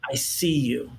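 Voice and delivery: very low, heartfelt; sincere reassurance. Acted. Speaking to hurting friends, sincere reassurance. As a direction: drop low and mean it tenderly.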